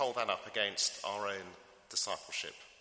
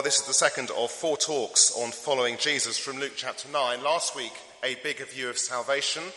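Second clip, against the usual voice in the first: ordinary talk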